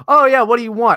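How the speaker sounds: mock exchange. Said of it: "It's like".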